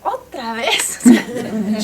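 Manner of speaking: slow, drawn-out mock exasperation with a laugh in it; laughing, drawn-out otra vez; exasperated parent. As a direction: tease him, stretching it out and laughing. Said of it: "lately I've been telling him".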